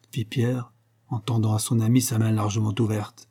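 ordinary delivery

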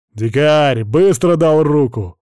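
gruff space marine bark; loud, slow barked order. A narrator's reading. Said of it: "рявкнул сержант". (рявкнул, как суровый десантник)

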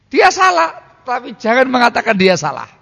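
high, loud example voice; acted advice. Preacher acting out advice to a husband, high and loud.

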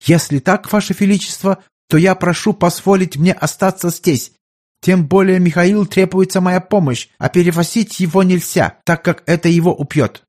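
put-on earnest German-accented physician addressing his sovereign; German doctor.

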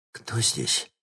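soft scared breath of a question; frightened breathy gasp. Acted. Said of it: "испуганно выдохнула она".

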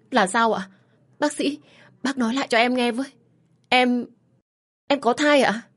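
begging the doctor to repeat the news; stunned joy. Acted. Wife asking the doctor again, high disbelieving joy. Wife hears she is pregnant.

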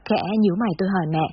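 plain reading voice

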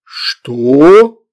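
loud, baffled question; a booming "what?" of incomprehension; uncomprehending. A narrator's reading. Demon fails to understand the child, baffled. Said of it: "не понял Фурундарок".

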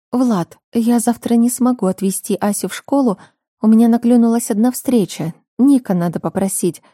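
lightly voiced mother; mother's voice.

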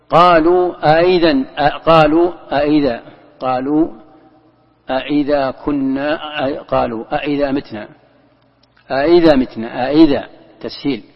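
أئذا with a softened second hamza and a lengthened vowel; tasheel reading. Shown as a demonstration. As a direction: ease the second hamza of أئذا so there is no hard stop. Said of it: "كيف التسهيل؟".

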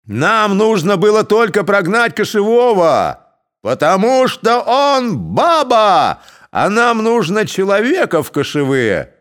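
loud, high, rowdy yell; mob shout. A narrator's reading. Cossacks telling the elders why, shouting. Mob demanding a new chief.

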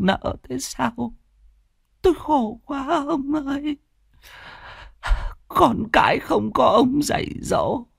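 a widow wailing to her dead husband's portrait; old widow lamenting. Acted. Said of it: "than khóc với tấm di ảnh". (giọng bà cụ cao, than khóc nghẹn ngào với chồng đã mất)

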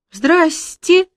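high timid squeak; squeaky. Acted. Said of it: "пискнула девица".

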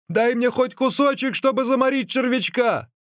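very high, urgent begging cry. A narrator's reading. Starving runner begs for a bite, crying out. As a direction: shout for a scrap of food. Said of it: "крикнул Ликуй".

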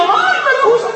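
very high whiny mock sob; mock crying. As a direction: whimper it like a spoiled child, for laughs. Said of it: "usted ve a Pedro llorando".

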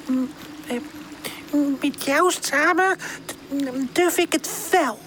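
put-on timid, frightened young character; anxious stammer. A narrator's reading. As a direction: falter fearfully, then dare to agree. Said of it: "stamelt hij angstig".

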